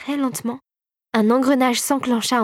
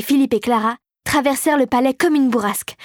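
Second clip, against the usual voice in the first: ordinary delivery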